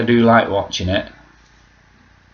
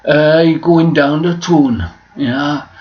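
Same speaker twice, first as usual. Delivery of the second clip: higher, accented narrator drifting into Welsh; botched regional voiceover impression, pitched up; Big Brother voiceover; 5 semitones higher, 4 dB louder than usual. Shown as a demonstration. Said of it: "the guy who always did Big Brother".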